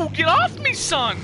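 high, loud mock yell; shouting. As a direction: yell it like a wounded soldier. Mock in-game soldier outcry.

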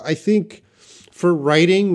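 ordinary talk

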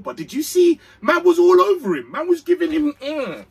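can you believe this, burst out; outraged outburst. Real, not acted. Fan fuming at Tottenham's handball claim, high loud outrage.

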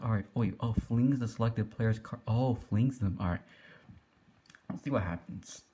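normal talking voice

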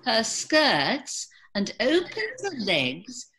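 high, lively British storyteller telling a bawdy tale; storyteller British accent. Acted. Tells bawdy folk tale in accent.

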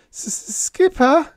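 put-on high, loud stuttering young voice; nervous crewman stuttering to the skipper; stammering panic. A narrator's reading. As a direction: stammer it out in terror. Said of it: "he managed".

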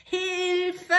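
high, loud yell for help; cry for help. Acted. Soldier falling into the well, yelling for help. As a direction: shout up the well shaft.

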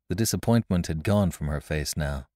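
ordinary delivery, nothing marked

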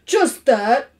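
loud, heavy sigh of words; sadly. A narrator's reading. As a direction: say it with hammed-up gloom. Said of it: "said Mister Carmody sadly".

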